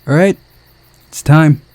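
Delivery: loud, high call to the crew; rally everyone to start. Acted. Director calling the crew to start, loud and high.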